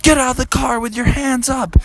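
high-pitched commanding shout; police officer. Acted. Police confront the family at hospital, high command. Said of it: "And the police said".

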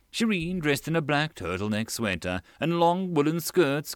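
ordinary delivery, nothing marked

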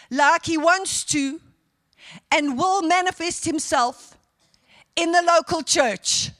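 emphatic prophetic quote with weighty pauses; declaimed prophecy. Retold or imitated. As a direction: declare it phrase by phrase with ringing force.

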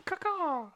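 mock crow caw. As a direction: caw like a raven for a joke. Imitates the Ravenclaw bird.

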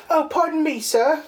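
high, dainty and polite; prim polite voice. Retold or imitated. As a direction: excuse yourself primly. Polite quote to Frankenstein.

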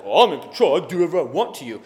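a lightly voiced smug bully, fast at the end; mock bully attitude. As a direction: toss it off like a swaggering bully. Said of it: "this attitude that Jesus has".

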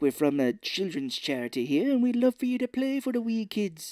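mock Irish accent, rising high at the end; Irish lilt, put on; Robin Williams. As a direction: do a loose Irish charity caller. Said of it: "doing, like, an Irish voice".

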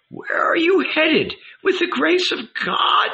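put-on high, curious old woman; old lady asking. A narrator's reading. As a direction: ask like a nosy old woman.

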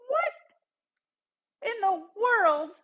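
very slow, stretched out and high; stunned disbelief. Retold or imitated. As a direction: drag it out in shocked disbelief. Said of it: "And I go".

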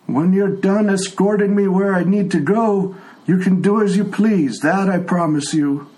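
put-on a frail old man who can't hear; old blind tiefling. Old tiefling NPC dialogue.

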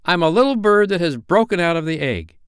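put-on high, lilting boy hero; exultant boyish proclamation; Peter. A narrator's reading. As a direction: boast joyfully, pitched well up. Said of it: "Peter answered at a venture".

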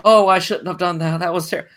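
mock remorse. Podcaster mocking the son's regret, whiny. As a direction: parody the son's fake regret.

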